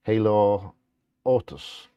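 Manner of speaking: ordinary delivery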